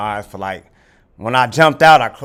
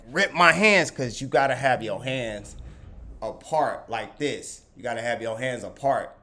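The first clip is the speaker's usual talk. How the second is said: ordinary delivery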